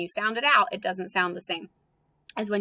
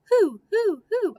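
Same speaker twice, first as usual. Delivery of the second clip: high, loud hooting owl; owl hoots; 12 semitones higher, 4 dB louder than usual. Pretend. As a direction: hoot it like an owl. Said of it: "if you think about an owl".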